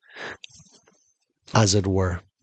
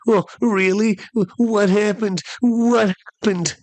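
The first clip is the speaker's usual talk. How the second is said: put-on high, eager childlike voice, much higher; 11 semitones higher, slower than usual. Comic asking the laughing men like a child. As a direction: ask it like an excited little kid. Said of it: "I said it like a child".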